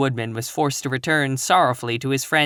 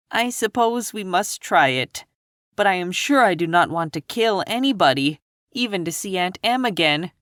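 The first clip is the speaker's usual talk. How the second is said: higher, lightly voiced girl; reluctant Dorothy; 6 semitones higher than usual. A narrator's reading. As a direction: agree reluctantly like a young girl. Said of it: "Dorothy dried her eyes and said".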